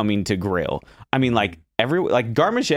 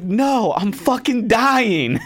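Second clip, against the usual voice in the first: high, loud strained wail of despair; desperate cry; 13 semitones higher, 5 dB louder, much slower than usual. Retold or imitated. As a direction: cry out like you're drowning in tickets. Overwhelmed cook answering chef.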